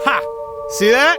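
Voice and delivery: high and loud, bursting with pride; thrilled. Acted. Showing the doubting listener his working magic, thrilled and loud. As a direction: show off the win, delighted. Magic trick finally works.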